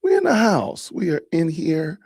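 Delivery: excited. Real, not acted. Streamer hypes his entrance.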